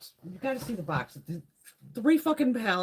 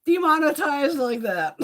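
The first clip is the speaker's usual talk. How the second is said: slow, louder, laughing through the words; 6 semitones higher, 7 dB louder, slower than usual. Real, not acted. Joking to viewers about getting demonetized, laughing. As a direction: crack up while dragging it out.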